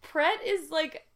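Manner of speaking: loud, high objection breaking into laughter; exasperated protest. Real, not acted. Co-host objecting to Rob's French coffee place, exasperated. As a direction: scold a friend in amused disbelief.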